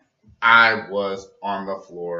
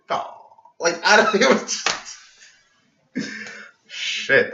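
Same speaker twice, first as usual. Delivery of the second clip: laughing; 10 semitones higher, 4 dB louder than usual. Real, not acted. Laughing remembering the joke.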